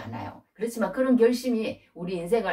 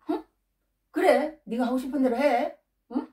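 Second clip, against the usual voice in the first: higher-pitched, dismissive mock consent; cold, curt indifference; 6 semitones higher than usual. Father-in-law now answering Jacob, cold and dismissive. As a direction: brush him off with a chilly go-ahead. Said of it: "막 이러면서 막 쌀쌀맞게 굽고".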